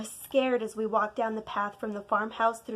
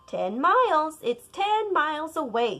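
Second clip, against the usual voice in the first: higher and louder grandmother; grandma announcing; 6 semitones higher, 6 dB louder than usual. Acted. Grandma judging the storm, louder. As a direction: announce it firmly.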